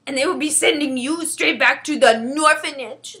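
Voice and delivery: loud, emphatic giant voice; worried friendly giant. Acted. BFG goes on.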